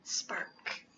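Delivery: soft, breathy bedtime whisper; hushed storybook whisper. A narrator's reading. Narrator whispering to sleepy children at bedtime. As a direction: whisper it so the little ones drift off.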